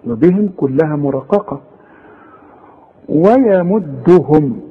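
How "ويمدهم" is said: ويمدهم drawn out slowly, light waw and ya; tajweed demo of ويمدهم; light recitation. Shown as a demonstration. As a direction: stretch ويمدهم slowly, letters thin. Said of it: "رقق الواو ورقق الياء".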